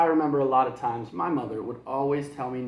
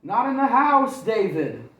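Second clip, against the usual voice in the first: very high, loud warning; alarmed mother's shout; mom shouting; 9 semitones higher, 8 dB louder than usual. Acted. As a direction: shout before he swings. Mom scolding baseball play.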